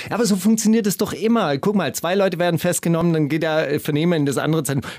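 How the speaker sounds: ordinary talk, nothing marked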